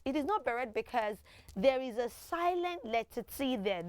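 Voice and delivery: usual speaking voice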